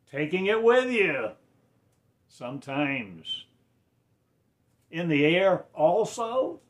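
loud, high sermon cry; impassioned pulpit delivery; fervent preaching. Acted. Rising preaching outburst.

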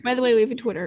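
ordinary delivery

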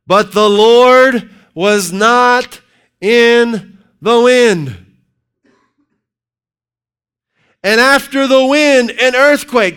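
high, loud, slow scripture reading; preaching scripture. Acted. Preacher reading First Kings aloud at full force. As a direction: thunder out the verse.

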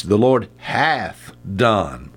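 slow, hath drawn out; The Lord HATH done, slowly; stress on hath. Shown as a demonstration. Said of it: "That's right, H A T H".

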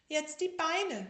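high, loud, slow demand; bossy sick tiger. Acted. Tiger directs the bandaging, high and slow. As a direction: give orders like a patient. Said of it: "sagte der kleine Tiger".

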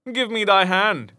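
a touch of a bluff cry; hearty call. A narrator's reading. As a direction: reach out with a glad cry. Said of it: "cried he".

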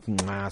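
mock smooching "mua"; exaggerated kiss sound. Joking with a friend about sports-bar passion, mock kiss. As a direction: plant a big pretend kiss.

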